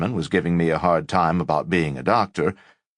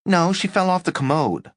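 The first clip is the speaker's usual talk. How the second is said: roommate voice; 12 semitones higher than usual. Acted.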